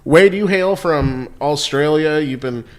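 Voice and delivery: ordinary delivery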